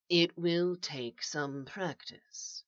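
soft, slow, patient voice; calm coaching. A narrator's reading. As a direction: reassure them quietly and slowly. Calm voice coaches trapped man.

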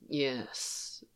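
a spent, rough murmur; roughened quiet yes. A narrator's reading. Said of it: "voice rough".

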